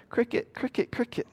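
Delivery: soft, high cricket chirps; imitating crickets. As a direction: chirp it quietly into an awkward silence. Imitates crickets in an awkward silence.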